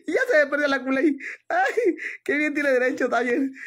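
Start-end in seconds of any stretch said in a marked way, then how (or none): none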